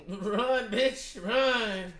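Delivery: high, loud shout urging him to run; yelling at the screen. Real, not acted. Viewer shouting at the fleeing man to run, loud and high. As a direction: cheer him on at full volume.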